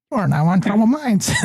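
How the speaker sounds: high mechanical robot voice. Retold or imitated. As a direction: announce it like an automated phone robot. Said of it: "the robot goes".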